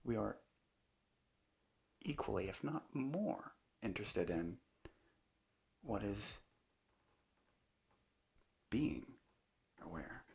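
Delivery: unhurried meditation teacher, long pauses between phrases; calm, measured guidance in short spaced phrases; guided meditation. Acted. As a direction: let each phrase settle into a long silence. Leads a guided meditation talk.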